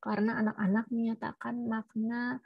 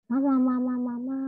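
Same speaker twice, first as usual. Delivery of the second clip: put-on baby babble; 4 semitones higher, 5 dB louder than usual. Shown as a demonstration.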